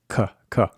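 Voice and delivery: k with a burst of air; English k, puffed out; breathy release on each k; aspirated k. Shown as a demonstration. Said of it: "without aspirating".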